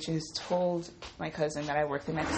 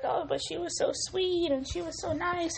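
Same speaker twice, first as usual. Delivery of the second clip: much higher, mocking imitation of the coworkers; mock sugary coworker; 12 semitones higher than usual. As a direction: parody their naive gushing in a high voice. Mimicking coworkers praising the cousin.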